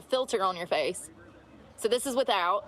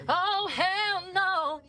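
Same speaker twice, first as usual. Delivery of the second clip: slow, higher, stretched out; drawn-out hell no; 8 semitones higher, much slower than usual. Real, not acted. Driver balks at the road ahead, drawn out. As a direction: stretch every word in disbelief. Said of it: "drawn-out repeat".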